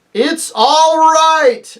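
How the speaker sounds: high, drawn-out lion's shout; joyous shout. Acted. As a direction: shout it out joyfully and slowly. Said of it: "shouted Aslan joyously".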